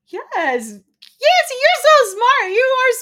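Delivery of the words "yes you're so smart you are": high, loud delighted squeal; shower them with praise; gushing praise. Real, not acted. Host gushing over a viewer, high and loud.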